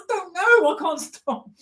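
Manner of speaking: very high mock wail; helpless footballer. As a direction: plead comic helplessness in a high voice. Retold Joey's helpless answer.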